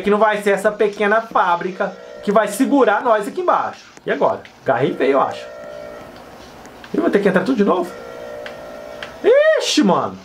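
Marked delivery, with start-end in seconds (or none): none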